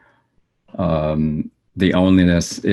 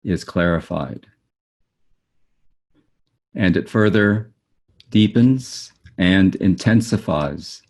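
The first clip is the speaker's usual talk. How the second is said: solemn, unhurried recital of a written teaching; formal reading aloud. Acted. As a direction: read it deliberately, phrase by phrase, letting each term stand. Reads own written description aloud.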